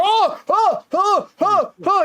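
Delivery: high rhythmic "oh" cries; high-pitched mock yelps; imitated cries of being swung. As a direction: yelp in bursts, mimicking someone flung around. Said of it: "And Moon's like".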